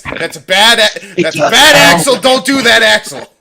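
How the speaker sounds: ordinary talk, nothing marked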